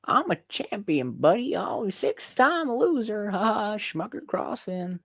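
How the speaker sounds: high, slow southern yokel; hammed-up southern imitation of a co-host; mock southern accent. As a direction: drawl it out high in a southern twang. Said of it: "That's how they talk down south".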